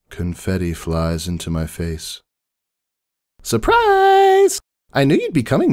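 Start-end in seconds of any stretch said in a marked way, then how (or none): 3.4-5.7 high, loud, squealing party pony; bubbly Pinkie Pie. A narrator's reading. Pinkie greets her sister Maud at the door, high and squealing. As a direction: burst out with a high, gushing surprise.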